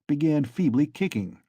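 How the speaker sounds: ordinary delivery, nothing marked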